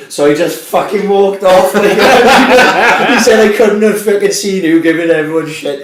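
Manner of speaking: high, loud laughing. Real, not acted. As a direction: laugh through the story. Laughing through friend's quote.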